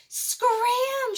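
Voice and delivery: high loud shout; panicked high yell. A narrator's reading. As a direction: bark a frantic warning. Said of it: "she shouted to the Parasaurolophus".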